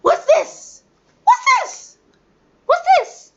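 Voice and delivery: excited preschool drill. Shown as a demonstration. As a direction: keep the little ones buzzing. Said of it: "continuing drill".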